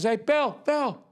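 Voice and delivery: high, insistent calls of Bill; heckler calling out. Retold or imitated. Heckler calling Bill from the crowd, high and insistent. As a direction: pester the comic over and over by name. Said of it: "This guy just goes".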